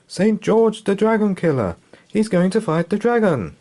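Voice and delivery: high, loud breathless news; frightened villager; urgent warning. Acted. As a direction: rush the terrible news.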